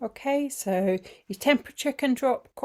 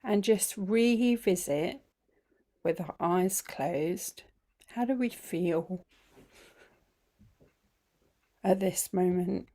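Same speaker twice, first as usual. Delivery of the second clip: guided meditation; 5 dB quieter than usual. Acted. Teacher easing the group into feeling the body, guided meditation. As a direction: guide them gently through it, phrase by unhurried phrase.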